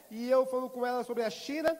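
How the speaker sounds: usual speaking voice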